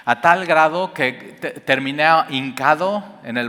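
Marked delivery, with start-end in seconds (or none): none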